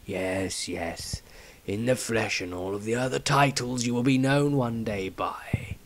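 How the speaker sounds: soft, unvoiced knowing whisper; whispered patriarch. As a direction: let it drop to a hush.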